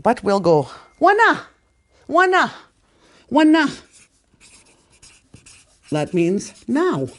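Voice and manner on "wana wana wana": "wana" punched high and loud; attempted elders' emphasis. Shown as a demonstration. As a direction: fire out each "wana" as a high, loud exclamation. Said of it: "I don't use those emphasis. I try".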